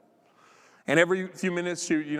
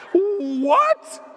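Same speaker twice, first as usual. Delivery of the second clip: high, loud mock-shocked yelp; startled; 10 dB louder than usual. Preacher acts out his reaction to his seatmate, startled. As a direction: blurt it out in exaggerated shock.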